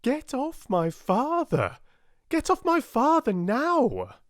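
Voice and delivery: furious daughter yelling at the tackler; shouted. Acted. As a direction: scream at him to stop. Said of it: "she shouted".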